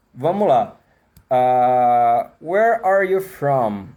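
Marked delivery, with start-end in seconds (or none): 2.4-3.9 slow, drawn-out where are you from?, every R curled back; English R demo. Shown as a demonstration. Teacher to students showing the English R, slow. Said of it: "Olha quantos R's tem na minha frase".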